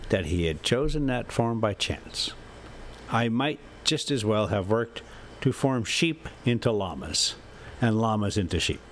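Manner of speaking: plain reading voice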